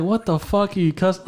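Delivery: high-pitched retold indignation; indignant retort. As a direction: protest in disbelief, voice jumping up. Retold indignant reaction to insult.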